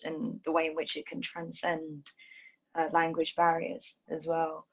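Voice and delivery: ordinary delivery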